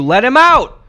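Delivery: loud high yell; shouting. Pretend. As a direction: demand it at full volume. Yelling to free the chimp.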